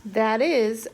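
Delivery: plain reading voice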